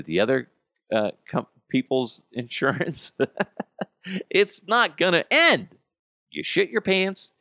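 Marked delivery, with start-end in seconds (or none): 4.3-7.3 high, loud exclamation; shouted disbelief; exclaiming. Real, not acted. Exclaiming about endless insurance mess.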